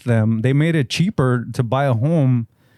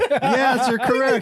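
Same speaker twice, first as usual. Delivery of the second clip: high, laughing squeaky reply; mock old lady; 13 semitones higher, slower than usual. High laughing old-lady voice answering a friend's guess.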